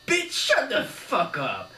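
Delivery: slow, loud, high thug bark; gangster shout. A narrator's reading. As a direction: silence her with a threat. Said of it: "shouted Arnie".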